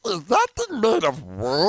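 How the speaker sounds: slow high chipmunk voice. Retold or imitated. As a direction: stretch it out in a high squeak. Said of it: "That voice just irritates me".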